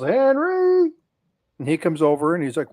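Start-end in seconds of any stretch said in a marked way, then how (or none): 0.0-0.9 loud, high call across the pond; wife calling her husband Henry across the ranch, loud yell. Retold or imitated. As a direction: holler his name from far off. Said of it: "And she yells".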